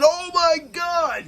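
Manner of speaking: high, slow, stretched-out mock exclamation; excited shout. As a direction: act out losing it over the chair shot. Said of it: "And I was going crazy myself".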